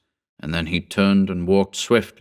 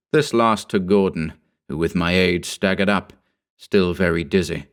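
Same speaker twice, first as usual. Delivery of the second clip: plain reading voice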